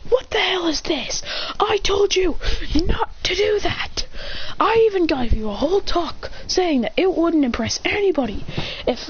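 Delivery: high voice, sometimes whispered, demanding a reason; mock furious demand. Sasuke demanding Boruto explain his cheating, high and pleading-angry. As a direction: press him again and again for an answer.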